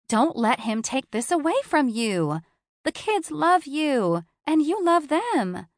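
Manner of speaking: a touch of a worried best friend; Capri, friend. A narrator's reading. Capri encourages.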